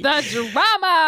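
loud, high, drawn-out exclamation; stretched-out diva delight; mock theatrical. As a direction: revel in it with hammed-up grandeur. Listener hams up the drama.

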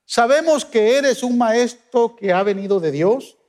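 ordinary talk

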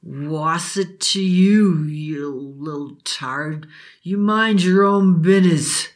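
slow, slurred, sneering; drunk father. Acted. Drunk father sneering at Billy, slow and slurred. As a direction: spit it out with drunken contempt. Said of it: "His father reeked of alcohol".

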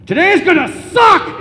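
loud, high-pitched blurt; sudden shout. Retold or imitated. Dad to his child at parting, sudden shout. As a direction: yell it out like a blunt announcement. Dad's punchline after tender goodbye.

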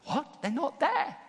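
high-pitched, rising disbelief; squeaky-high astonished query; incredulous priest. Acted. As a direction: echo the news as a stunned question. Priests hear prisoners are gone.

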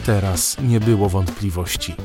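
ordinary delivery, nothing marked

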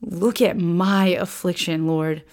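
slow, soft, grieving plea; a hint of the widow lamenting; mournful plea. Lady Zion interrupting to lament to the Lord, slow and soft. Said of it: "she interrupts and she's like".

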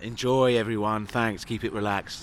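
low, mellow sign-off; Neil. Acted. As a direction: sign off deep and laid-back. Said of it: "I'm doing Neil".

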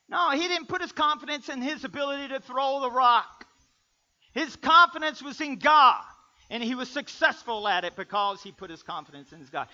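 loud, emphatic preacher's correction; full-force preaching. Real, not acted. Preacher explaining where David's confidence was, full-force preaching. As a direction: drive it home to the congregation.

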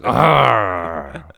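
short growl through the teeth; mock growl. Imitating the wrestler's growl for friends. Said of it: "He's got that growl".

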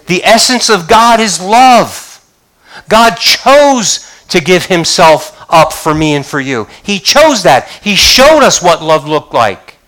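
rising, impassioned sermon on love; fervent preaching. Acted. Pastor urging the congregation with impassioned preaching. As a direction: build the fire as you preach.

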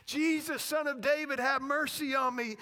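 loud, high imitated yell. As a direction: yell the plea over the crowd. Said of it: "he keeps yelling louder and louder".

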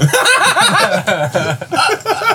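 loud squealing laugh; a high, loud laugh bursting out; real high laughter. Real, not acted. Laughing at his own joke.

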